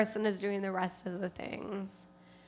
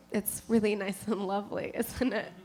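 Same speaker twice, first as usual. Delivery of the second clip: ordinary talk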